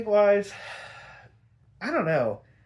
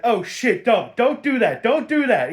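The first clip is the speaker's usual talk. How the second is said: loud, high mock panic; alarmed pleading; 5 semitones higher, 7 dB louder than usual. Mad Hatter to Bruce on the ledge, alarmed pleading. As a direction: imitate someone urgently stopping a jumper. Villain begs Bruce not to jump.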